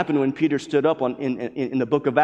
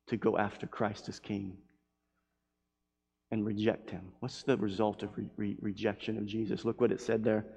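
hushed solemn preaching; 6 semitones lower, 11 dB quieter than usual. Acted. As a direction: draw them in with a hush.

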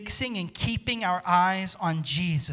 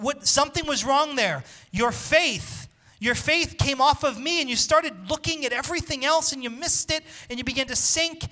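raised high, earnest voice of Jesus; Jesus explaining; 7 semitones higher than usual. Acted. Pastor voicing Jesus telling Peter why he sank, in a high voice. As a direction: plead with him as you point out the slip.

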